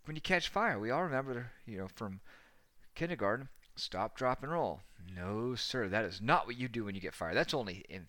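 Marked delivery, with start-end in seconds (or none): none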